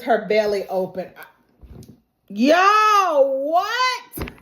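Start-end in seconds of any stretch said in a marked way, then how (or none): 2.3-4.3 loud high drawn-out outburst; shocked disbelief. Real, not acted. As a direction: stretch both words in astonishment. Own reaction to gory scene.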